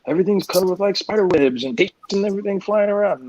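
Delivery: high, gushing fanboy wonder; hammed-up high-pitched enthusiasm; mock wide-eyed excitement. Mocks naive view of comics business.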